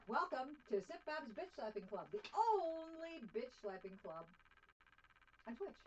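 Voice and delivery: hammed-up show intro; mock announcer. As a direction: announce it like a ringmaster.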